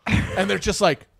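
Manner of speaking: usual speaking voice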